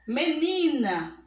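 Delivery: "Menina" slowly, with a long strong ni; teacher's demo of "Menina", weight on ni; stressed middle syllable. Shown as a demonstration. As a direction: stretch the ni in "Menina". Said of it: "o ni".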